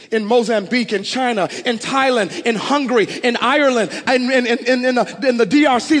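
loud, high-pitched preaching at full force; impassioned preaching. Real, not acted. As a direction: preach it hard, driving each repeated phrase home.

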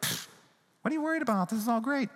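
a flippant pfft, waving it away; dismissive Jesus. Pretend. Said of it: "Don't hear Jesus saying, like".

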